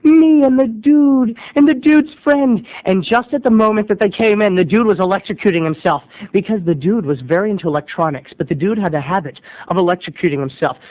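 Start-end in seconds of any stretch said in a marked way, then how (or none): none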